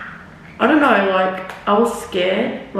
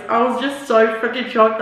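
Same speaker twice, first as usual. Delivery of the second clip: usual speaking voice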